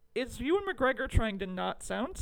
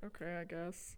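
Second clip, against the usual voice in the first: quiet, lower, half-hearted acceptance; reluctant agreement; 4 semitones lower, 13 dB quieter than usual. Acted. Actor going along with the director's request, reluctant. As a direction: give in softly, without conviction. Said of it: "And he was like".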